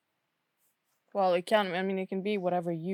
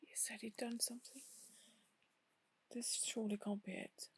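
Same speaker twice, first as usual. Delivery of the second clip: very soft, half-whispered musing; hushed, troubled whisper; shaken whisper; 13 dB quieter than usual. Acted. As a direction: think aloud under your breath. Shaken character puzzling over the vision.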